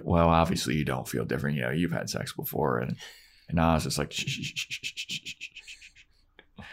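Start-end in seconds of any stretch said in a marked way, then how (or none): 4.1-6.6 shushing. Retold or imitated.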